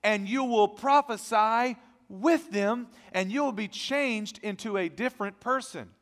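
loud, high, ringing pulpit voice; full-force preaching. Acted. Pastor preaching loudly to his congregation, full force. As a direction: preach it out to the whole church.

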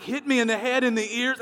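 ordinary delivery

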